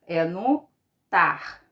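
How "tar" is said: "tar" said alone, loud and falling; stressed syllable. Shown as a demonstration. Teacher isolates the tonic syllable for pupils, stressed "tar". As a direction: land firmly on "tar" as the strong syllable. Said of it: "o tar é a sílaba tônica".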